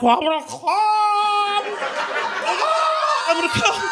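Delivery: strained warning. Retold or imitated. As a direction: hold back the climax, stretching the words. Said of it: "I'm like".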